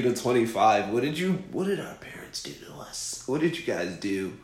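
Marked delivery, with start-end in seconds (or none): none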